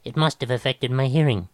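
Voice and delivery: mildly frantic realisation; a touch of panic in the voice. A narrator's reading. Musician blaming the trip for his hearing, mildly frantic. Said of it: "he decided frantically".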